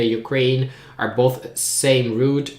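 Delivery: usual speaking voice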